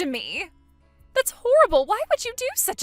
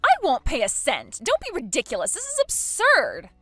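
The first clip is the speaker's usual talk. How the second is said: louder, rising outrage; indignant shouting; 3 semitones higher, 7 dB louder than usual. Acted. Bride refusing to pay a cent, angry shout. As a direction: shout it down as absurd.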